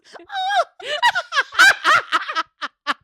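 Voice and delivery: high, put-on moaning breaking into laughter; mock sex noises. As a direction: moan high as a joke, then laugh. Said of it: "Just sex noises".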